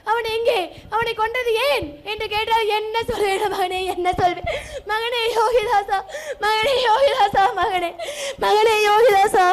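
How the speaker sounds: keen over the dead son, voice breaking into sobs; wailing lament. Acted. Mother laments her dead son.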